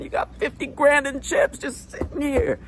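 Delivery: high, slowing mock wonder. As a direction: marvel at the chips, way up high.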